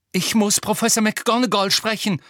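high, loud breathless demand; gasping urgency. A narrator's reading. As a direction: burst out as if winded from running. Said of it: "keuchte Harry mit zum Reißen gespannten Lungen".

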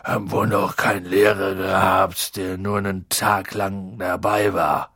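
low, woozy character voice; tipsy and gloomy; drunk, sluggish Hagrid. Acted.

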